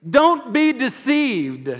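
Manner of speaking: slow it down and lean on it; stern warning. Real, not acted. Preacher warns congregation.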